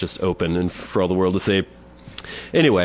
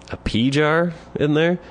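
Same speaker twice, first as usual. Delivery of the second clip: incredulous; 8 semitones higher, slower than usual. Retold or imitated. Incredulous question in retold story.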